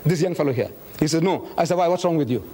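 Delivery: usual speaking voice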